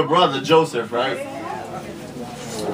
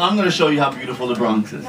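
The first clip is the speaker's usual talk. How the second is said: usual speaking voice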